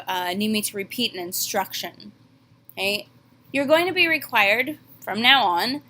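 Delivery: ordinary delivery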